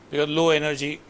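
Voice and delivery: ordinary talk, nothing marked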